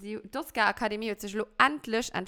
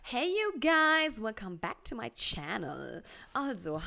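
YouTuber intro voice; slower than usual. Acted. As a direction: sing out a slow influencer welcome. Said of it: "mach mal einen Haul".

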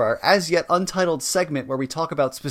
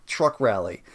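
ordinary talk, nothing marked

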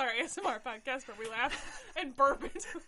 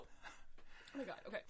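breathless laughing whisper; 7 semitones lower, 19 dB quieter, slower than usual. Real, not acted.

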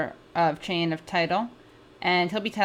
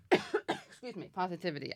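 hushed, softer, as if choked up; mock verklempt; 4 dB quieter than usual. Host teasing co-host, pretending to be choked up. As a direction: play at being moved to tears, understated. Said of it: "I got so verklempt from that".

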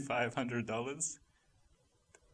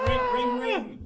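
high sing-song ringing; phone ring; 6 dB louder, slower than usual. Acted. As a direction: chime like a phone.

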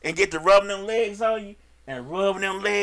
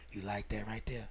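hushed low murmur, much softer and lower; seductive whisper; 12 semitones lower, 18 dB quieter than usual. Retold or imitated. Masseur whispering to his client, a low seductive murmur. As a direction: breathe it into her ear like a smooth masseur. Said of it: "whispering shit in your ear".

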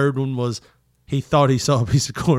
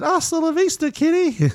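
much higher, playful action-hero line; movie one-liner; 14 semitones higher than usual. Retold or imitated. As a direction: toss off the catchphrase with glee. Quoting movie one-liner as kid.

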